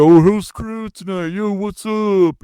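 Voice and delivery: sneering parody of a cheesy stage host; mock showman greeting. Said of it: "I don't get up there".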